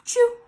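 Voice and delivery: high sudden sneeze. Acted. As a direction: let a big sneeze burst out. Sneeze sound acted.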